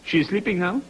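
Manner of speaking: lightly imitated French accent, calm; unbothered French-accented phone voice, rising slightly; French man. Frenchman telling the caller she's asleep, imitated French accent. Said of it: "Again, I hear, "Bonjour".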